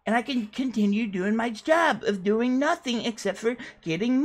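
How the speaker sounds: high, boastful put-on voice for the whole rant; Sweetie character voice. Interviewee bragging to the interviewer in a high put-on voice. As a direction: brag like a clueless internet star.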